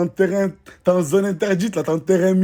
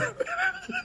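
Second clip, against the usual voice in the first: shrill laugh; 13 dB quieter than usual. Real, not acted. Laughing at threats in comments.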